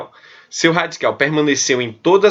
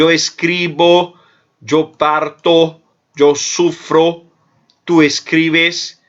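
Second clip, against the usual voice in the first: each verb form spaced out slowly; slow dictation; much slower than usual. Shown as a demonstration. Teacher drilling conjugations for students, slow and spaced. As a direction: drill it word by word for the class.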